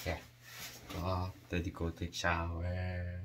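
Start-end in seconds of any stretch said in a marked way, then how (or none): none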